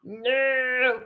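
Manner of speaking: a firm stern no, lightly voiced; a hint of a strict mom. Shown as a demonstration. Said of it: "That's my mom voice".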